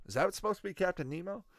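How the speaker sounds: normal talking voice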